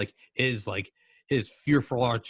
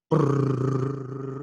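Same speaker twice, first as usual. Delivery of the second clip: rolling lips mimic distant guns rumbling; imitated drum roll fire; 3 semitones higher, 4 dB louder than usual. Describing the front lines to a friend, rolled artillery rumble. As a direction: brrr it out like far-off artillery.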